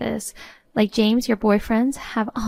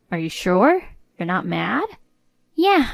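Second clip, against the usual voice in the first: ordinary delivery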